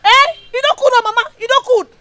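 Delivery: loud, fast, high-pitched tirade; angry rant. Acted. Daughter ranting at her mother, loud and fast.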